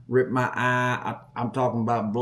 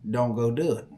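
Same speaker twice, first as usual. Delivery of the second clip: usual speaking voice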